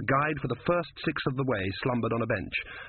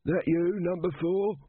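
put-on high, thin elderly man; old man voice; 7 semitones higher than usual. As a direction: voice a frail old guide. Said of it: "An elderly voice said".

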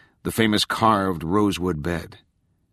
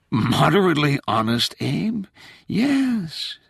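playful ghost voice; 9 semitones higher, slower than usual. Acted.

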